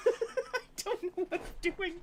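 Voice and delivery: words squeezed out through high giggles; can't stop laughing while talking. Real, not acted. Reader cracks up mid-reading.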